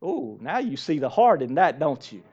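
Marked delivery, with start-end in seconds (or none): none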